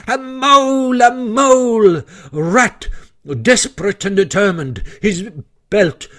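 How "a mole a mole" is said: high-pitched battle yell; raised, ringing call to battle; war cry. A narrator's reading. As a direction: shout it as a fierce little war cry. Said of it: "shouting his awful war cry".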